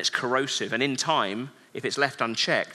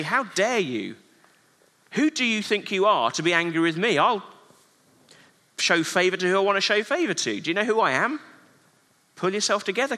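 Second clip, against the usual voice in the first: raised, indignant rebuke, higher pitched; angry God; 5 semitones higher than usual. Pretend. A wrathful God rebuking Cain, higher and indignant. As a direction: scold Cain like an offended ruler. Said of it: "he doesn't come to Cain and say".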